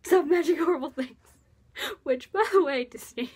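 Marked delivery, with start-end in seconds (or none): 0.0-1.2 a touch higher and louder, flustered; flustered Cyrus quote. Retold or imitated. Cyrus hurriedly reassuring his friend, mildly flustered. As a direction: play it as mildly panicked backpedaling. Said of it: "And Cyrus is like".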